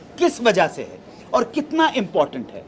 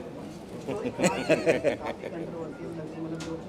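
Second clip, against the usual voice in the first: low, soft chuckle; a real chuckle at one's own remark; laughing; 3 semitones lower, 9 dB quieter than usual. Real, not acted. हँसते हुए reject वाली बात. (धीमी, दबी हँसी)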